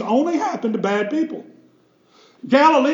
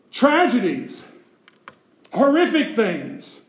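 ordinary talk, nothing marked

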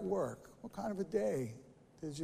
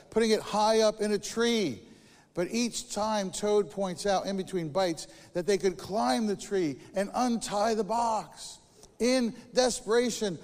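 ordinary talk

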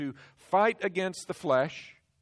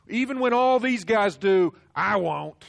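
loud, high, scornful brag; loud boast; 6 semitones higher, 10 dB louder than usual. Acted. Peter bragging over the others, loud and high. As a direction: puff up like Peter.

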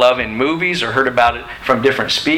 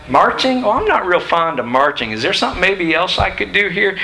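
mock-whiny soldier, pitched higher; whiny recruit; 7 semitones higher than usual. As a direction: play a recruit haggling with his officer. Mocking a soldier questioning orders.

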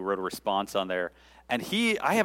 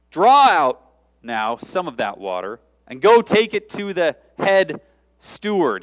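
commanding Jesus; 4 semitones higher, 8 dB louder, slower than usual. Pretend.

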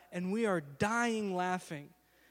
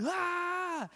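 higher cry of pain; mock cry; 8 semitones higher than usual. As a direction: cry out from the fall. You're sitting there going.

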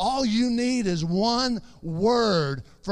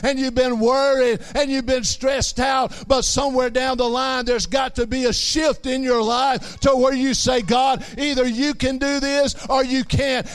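raised, driving sermon voice, building; rising preaching; 4 semitones higher than usual. Acted. Preacher urging struggling listeners to pray, rising preaching. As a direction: push the congregation toward a shift.

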